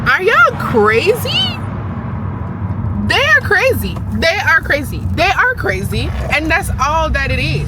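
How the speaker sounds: they are crazy, hit it hard; excited rant. Real, not acted. Excited rant about nail people.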